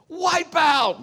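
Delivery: exclaiming the crash loud and high; loud wipe out. Retold or imitated. Father recalls the bike crash, loudly exclaiming. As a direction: call out the crash as it happens.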